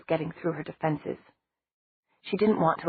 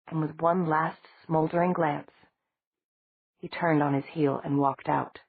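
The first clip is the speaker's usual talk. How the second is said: ordinary delivery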